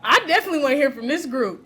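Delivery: loud, laughing call to regroup; calling out to the room. Real, not acted. Facilitator calls the chatting groups back, laughing and loud. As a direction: raise the voice over the chatter, still laughing.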